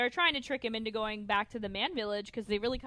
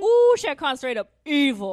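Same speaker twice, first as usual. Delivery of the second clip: loud, higher outburst; all caps; 4 semitones higher, 11 dB louder than usual. Retold or imitated. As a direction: shout it like an all-caps text. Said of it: "in, like, all caps".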